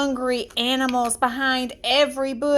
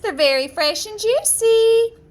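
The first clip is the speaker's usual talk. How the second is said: high, loud sing-song peddler voice; a disguised queen tempting her sweetly; put-on crone seller, high and coaxing; old apple seller; 8 semitones higher, 6 dB louder than usual. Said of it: "dressed up as an old apple seller".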